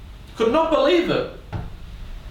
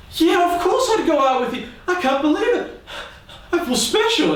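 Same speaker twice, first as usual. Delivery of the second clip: put-on much higher, giddy girl's voice with a laugh; delighted girl; 14 semitones higher than usual. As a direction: gush like a thrilled schoolgirl. Girl accepting, thrilled.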